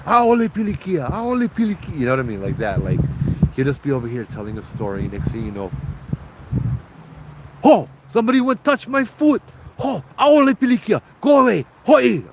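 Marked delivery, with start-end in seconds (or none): 0.1-2.0 much higher, louder mock yell of aole pilikia; yelling in Hawaiian. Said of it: "yell and scream in Hawaiian". 7.6-12.2 much higher, louder hammed-up yell; Uncle Joe yelling. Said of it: "he starts yelling".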